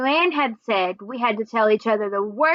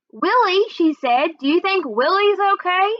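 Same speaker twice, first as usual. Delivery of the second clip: worried; 7 semitones higher than usual. A narrator's reading. Carmela worried about her dog.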